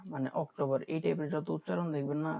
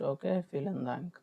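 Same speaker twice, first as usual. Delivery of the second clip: ordinary delivery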